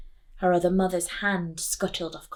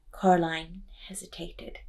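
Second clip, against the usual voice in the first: ordinary delivery, nothing marked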